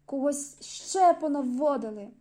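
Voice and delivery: loud outraged protest, slowing at the end; indignant man refused entry, shouting at the ticket taker. Acted. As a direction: shout it like an offended gatecrasher. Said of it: "Фігура кричала".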